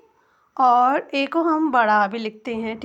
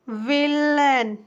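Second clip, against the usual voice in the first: Villain drawn out slowly as "ve-len"; slow model word; much slower than usual. Shown as a demonstration. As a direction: stretch villain to show the vowel. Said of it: "आ की ध्वनि आ रही है". (Villain धीरे-धीरे खींचकर)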